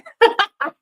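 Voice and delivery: squeaky giggle; high squealing laugh. Real, not acted. Laughs at herself.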